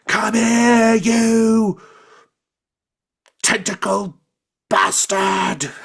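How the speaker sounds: high, loud screeching goblin cry; shrill-free high goblin bellow at the squid; goblin battle yell. Acted. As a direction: shriek it like a furious little goblin. Goblin yelling at squid in fight.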